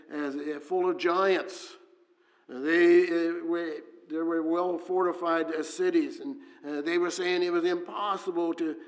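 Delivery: rising fervent sermon delivery; preaching at full force. Acted. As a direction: preach it out to the congregation.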